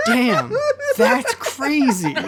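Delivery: dumbfounded amazement. Retold or imitated. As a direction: play it wide-eyed and gullible, dragging out crazy. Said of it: "I also remember being like".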